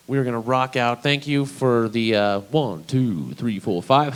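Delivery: ordinary talk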